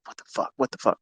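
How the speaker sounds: soft, puzzled under-the-breath what the fuck; baffled mutter. Retold or imitated. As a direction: mutter it like a confused trader. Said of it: "you can see he's like".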